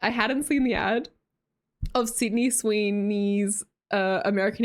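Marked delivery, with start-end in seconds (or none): none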